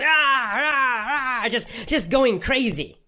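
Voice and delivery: high, cracking cries of overexcitement; wild excitement. Shown as a demonstration. Reliving his speech, a high excited cry and laugh. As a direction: burst out with manic energy. Looked kind of crazy.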